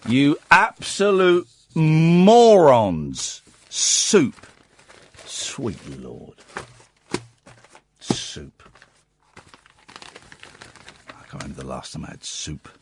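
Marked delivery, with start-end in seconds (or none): 0.1-4.5 slow, drawn-out exasperated insult; hammed-up disgust at the listeners; mock outrage. Outraged at soup replacing roasts. 5.2-8.6 very low, hushed dismay; quietly aghast; appalled disbelief. Acted. Appalled reaction to soup.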